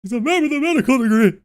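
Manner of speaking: high, fast mock panic yell; man down shout. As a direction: squeal the quote in a frantic voice. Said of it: "I just".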